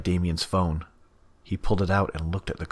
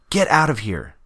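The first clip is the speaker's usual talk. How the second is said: loud, higher, sharp dismissal; angry order; 7 semitones higher, 8 dB louder than usual. Acted. Damien ordering Scratch out in anger. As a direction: throw her out.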